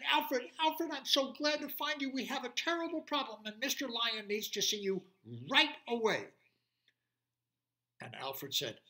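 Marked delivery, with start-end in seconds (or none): 0.0-6.3 high, louder eager voice; baby deer. Acted. Baby deer finding Alfred, high and eager. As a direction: gush with relief like a little fawn.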